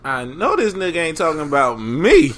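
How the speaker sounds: ordinary delivery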